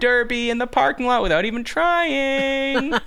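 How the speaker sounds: high-pitched hammy heckle; rodeo clown announcer. Retold or imitated. Clown announcer mocks parking lot drivers, high hammy jeer. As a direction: crow it out like an arena clown. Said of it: "basically a rodeo clown".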